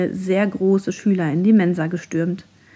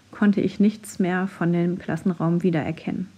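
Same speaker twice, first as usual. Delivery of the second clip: usual speaking voice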